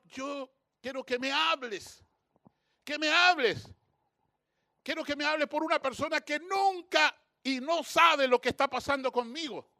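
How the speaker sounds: súplica insistente. Retold or imitated. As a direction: plead and demand, building in force. Pleading demand in retold prayer. (súplica insistente en voz alta)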